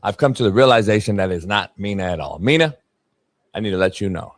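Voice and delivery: low, soft, hushed; mock sincere apology. Real, not acted. Apologizing to Mina, mock tender low voice. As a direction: fake heartfelt calm.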